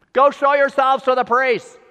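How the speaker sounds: called out loud and high across the distance; yelled back. Retold or imitated. Jesus yelling back to the lepers fifty yards away, loud call. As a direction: yell it back to them from far off. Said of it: "He just yelled back".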